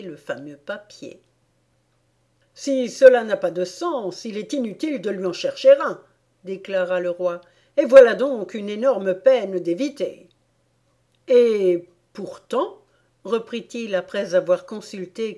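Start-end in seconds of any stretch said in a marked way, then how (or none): 2.5-6.0 loud, brisk royal pronouncement; declaring king. A narrator's reading. The king ruling on the meaningless poem, declaring loudly. 7.8-10.3 loud, emphatic royal verdict; declaring king. A narrator's reading. The king satisfied to drop the poem, declaring. King continues his ruling. 11.3-12.8 loud, drawn-out second thought; pondering king. A narrator's reading. The king looking at the poem once more, pondering.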